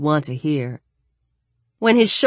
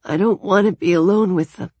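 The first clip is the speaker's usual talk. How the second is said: higher-pitched, frail plea; fragile voice; 4 semitones higher than usual. Acted. Daniel fears being alone with them, fragile. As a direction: confess it weakly, after crying. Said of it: "he said in that same fragile voice".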